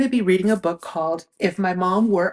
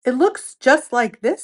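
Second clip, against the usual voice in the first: louder, higher Fox voice; eager fox, louder; Fox showing his sock; 5 semitones higher, 7 dB louder than usual. Acted. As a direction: proudly hold up the sock. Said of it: "said Fox".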